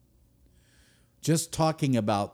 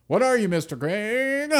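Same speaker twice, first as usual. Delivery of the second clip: mock question; 10 semitones higher than usual. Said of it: "asks himself".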